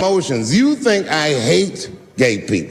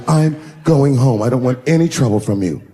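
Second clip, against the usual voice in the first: a coy, wounded sultry exit; 4 semitones lower than usual. Retold or imitated. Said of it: "sultry voice".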